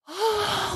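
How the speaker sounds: a big high, loud sigh of relief; long exhale after scary news; huge relieved sigh. Retold or imitated. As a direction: let all the worry out in one breath. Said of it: "like, the biggest breath of fresh air".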